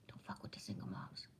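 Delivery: hushed whispered movie line, breath only. Retold or imitated. Quoting Cuba Gooding Junior to Jerry Maguire, whispered. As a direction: drop to a whisper for the repeat. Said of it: "When Cuba Gooding Junior says".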